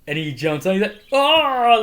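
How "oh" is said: loud, high frustrated cry; tantrum yell. Retold or imitated. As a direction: imitate a player throwing a tantrum. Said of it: "in a tantrum, like".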